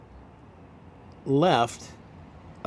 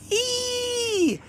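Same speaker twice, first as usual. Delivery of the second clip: very high excited shriek of recognition; high happy squeal; delighted squeal. Retold or imitated. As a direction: squeal like a fan spotting a friend. Katie recognizes him, squeals.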